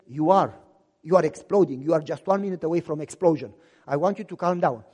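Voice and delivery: wife calming her fuming husband, low and firm; wife's calming voice. Retold or imitated.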